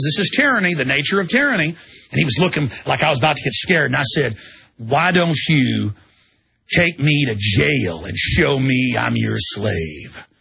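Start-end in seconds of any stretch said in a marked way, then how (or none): 4.8-10.3 very slow, low, deliberate challenge; slow defiant retort. Retold or imitated. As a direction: drag it out as a dare.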